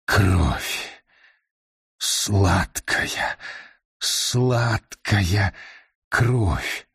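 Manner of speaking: low moaning hunger; sobbing ghost voice. Acted. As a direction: moan the words like a starving ghost. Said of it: "застонал призрак".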